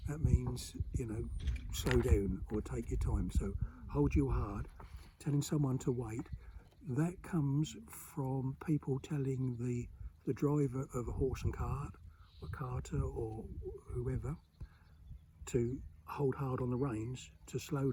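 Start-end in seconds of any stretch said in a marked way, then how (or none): none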